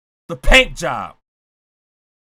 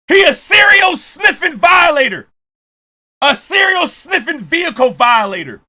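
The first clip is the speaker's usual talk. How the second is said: comic shouting rant; 4 semitones higher than usual. Real, not acted. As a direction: keep shouting in comic disbelief.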